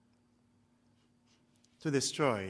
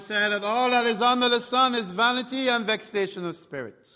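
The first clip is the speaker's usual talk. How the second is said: louder, higher preacher's declaration; full-force preaching; 6 semitones higher, 6 dB louder than usual. Acted. Preacher quotes Solomon to the congregation, preaching. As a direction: proclaim it to the congregation.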